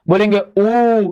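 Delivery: slow held ऊ, tongue back; drawn-out vowel ऊ. Shown as a demonstration. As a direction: stretch ऊ long. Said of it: "बस बोल के आप समझ जाएंगे".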